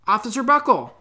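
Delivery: high, loud eager call; high excited call to Officer Buckle; eager callers. Acted. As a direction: school staff hailing the officer. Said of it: "they said".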